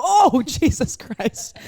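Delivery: high, stretched-out mock fright; startled. Friend suddenly finding the man right there, mock startled cry. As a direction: act out a jump scare, half laughing. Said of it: "all of a sudden you go".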